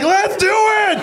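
very high, shouted dog rallying cry; a hyped dog yelling go; dog's very high excited shout. Retold or imitated. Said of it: "the other one's like".

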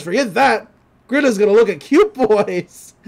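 put-on loud, boisterous brute of a girl; gruff Grinda. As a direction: bellow it with gusto. Grinda voiced in recap.

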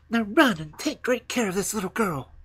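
high, loud, firm send-off; urging robber girl. Acted. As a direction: command the reindeer in a high girl's voice. Robber girl sends reindeer off.

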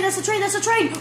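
usual speaking voice

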